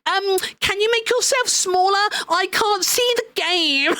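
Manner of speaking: put-on pestering viewer voice; bozo in chat. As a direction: imitate a whiny chat complaint, dragging out the end. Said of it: "some fucking bozo in chat".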